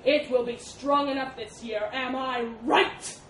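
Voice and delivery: loud, furious elephant tirade; spat accusations. A narrator's reading. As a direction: hammer the boar with mounting accusations. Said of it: "spat the elephant".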